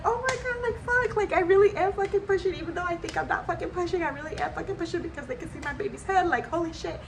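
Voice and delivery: high, rushed, swearing in amazement; amazed disbelief. Retold or imitated. Mother in labor realizing the baby is coming, high amazed rush. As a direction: spill it out fast, stunned and thrilled. Realizing she really is pushing.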